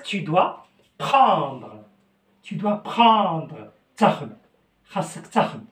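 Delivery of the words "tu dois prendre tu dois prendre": very slow, each word laid out; slow dictation pace; slow teaching demo. Shown as a demonstration. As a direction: spell it out slowly for the class. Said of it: "teacher drills the phrase".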